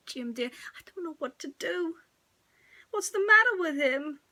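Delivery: high, anxious wife; worried young mother voice. Acted. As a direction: fret over the crying baby.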